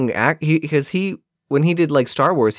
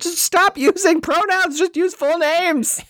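mock high voice; 14 semitones higher than usual. Mocking the characters' dialogue.

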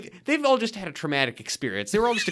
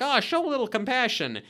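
wood head voice; 9 semitones higher than usual. Retold or imitated. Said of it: "going like".

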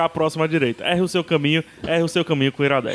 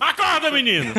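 mock wake-up call; 10 semitones higher than usual. Waking a sleeping listener.

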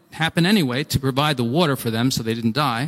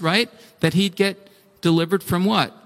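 ordinary talk

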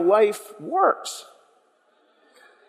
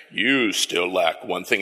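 much lower, slow menacing Vader voice; Darth Vader voice; 11 semitones lower, 3 dB quieter, slower than usual. Pretend. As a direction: drop it low and ominous like Vader. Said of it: "it's kind of a Darth Vader voice".

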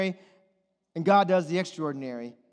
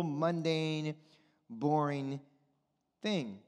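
ordinary talk, nothing marked

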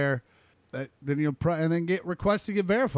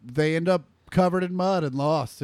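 usual speaking voice

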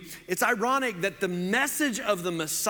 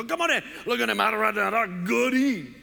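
loud, high, excited Cajun; Cajun neighbor; 5 semitones higher, 4 dB louder than usual. Retold or imitated. Neighbor shows off his catch in the boat, excited Cajun holler. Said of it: "that was my neighbor".

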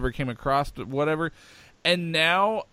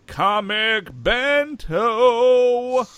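"Comic Bento" stretched very slowly, higher and louder; announcer voice; 8 semitones higher, 7 dB louder, much slower than usual. Shown as a demonstration. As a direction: sell the name like a hammed-up ad announcer. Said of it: "uh, Comic Bento".

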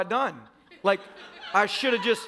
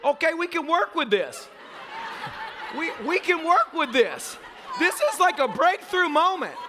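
high-pitched, laughing mock optimism; mock excitement; 8 semitones higher than usual. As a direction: squeal it like a great breakthrough. Mock relief after grudging yes.

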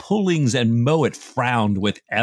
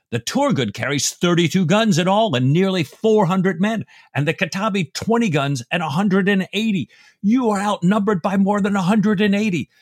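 higher, louder indignant protest; indignant rebuke; 9 semitones higher, 4 dB louder than usual. Retold or imitated. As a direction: scold the captain in rising outrage. Graham's angry rebuke read aloud.

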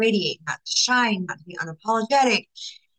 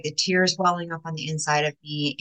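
ordinary delivery

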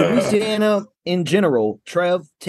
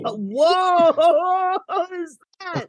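high, laughing whoa; amazed and laughing; surprised; much slower than usual. Real, not acted. Reacting to a surprise on camera.

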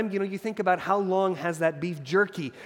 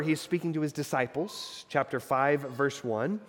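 ordinary delivery

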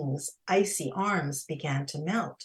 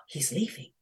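ordinary delivery

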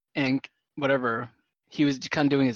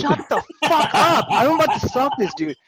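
high, loud fed-up yell; retold angry outburst; 10 semitones higher, 5 dB louder than usual. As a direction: tell him off, loud and high. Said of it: "I'm like".

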